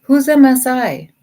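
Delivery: who on earth is that; puzzled outburst. Real, not acted.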